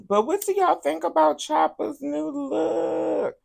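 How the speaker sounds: a mock-dramatic high squeal of a question; high sassy falsetto. Real, not acted. Host teasing viewers about a rapper's hair, high falsetto.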